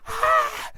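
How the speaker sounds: loud, high imitated shriek; startled scream. Cindy screaming at the Grinch, loud mock shriek. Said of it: "And then Cindy's like".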